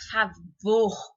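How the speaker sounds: favor with VOR held and stronger; stress on second syllable. Shown as a demonstration. Teacher showing pupils the tonic syllable, stress on VOR in favor. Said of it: "o vor tem a maior intensidade".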